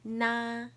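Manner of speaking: "Na" held high and level; first tone. Shown as a demonstration. Teacher showing the four tones, "Na" high and level. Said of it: "I'll say the four tones".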